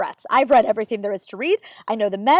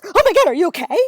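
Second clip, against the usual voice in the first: startled mock gasp, higher and louder; alarmed; 6 semitones higher, 7 dB louder, faster than usual. Dermatologist startled by a tic, alarmed and loud. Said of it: "and she goes".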